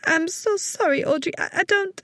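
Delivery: put-on high, tearful young woman; crying. A narrator's reading. Young woman apologizing to her boss, close to tears. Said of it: "the poor young woman was crying".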